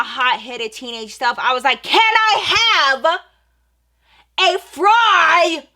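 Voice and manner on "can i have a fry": high, loud, slow, every word dragged out; mock-polite high yell. Teen shouting her order at the rude cashier, high, loud and drawn out. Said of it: "Let me take it up three or four octaves".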